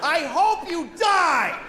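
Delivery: mock furious shout; road-rage curse. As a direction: spit it at the other driver. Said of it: "I said that to a person".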